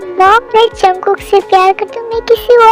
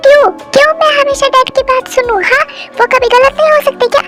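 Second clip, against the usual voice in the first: high, indignant questioning; defiant outburst; 9 semitones higher than usual. Acted. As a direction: fire the questions back, pitched high. Daughter defies mother's plea. (ऊँची आवाज़ में तीखा सवाल)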